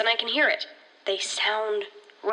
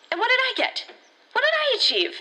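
much higher and rising to a cry, hitting did; pitched-up angry cry of frustration; anguished outburst; 12 semitones higher than usual. Acted. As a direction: let the hurt burst out as she asks. Outburst about feeling worthless.

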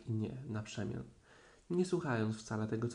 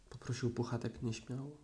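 ordinary delivery

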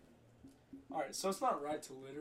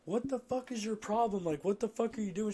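retold angry yell; 7 semitones higher, 6 dB louder than usual. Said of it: "I said, I was like".

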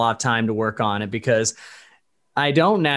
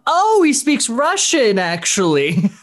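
slow, much higher, louder put-on surprised voice; mock surprise; 11 semitones higher, 4 dB louder, slower than usual. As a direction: act out the crew's amazed discovery. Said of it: "everyone went".

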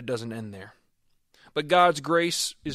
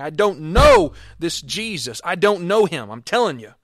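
cursing denial; 3 semitones higher, 11 dB louder than usual. Acted. Peter cursing at the bystanders, loud and furious. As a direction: hurl the denial at them. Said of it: "He was cursing and swearing".

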